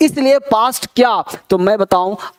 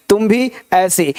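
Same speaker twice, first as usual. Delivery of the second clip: ordinary delivery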